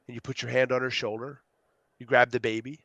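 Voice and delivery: normal talking voice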